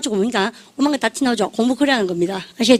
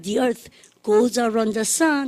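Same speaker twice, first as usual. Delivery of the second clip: slow choral model; 3 dB quieter, much slower than usual. Shown as a demonstration. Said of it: "model sentence".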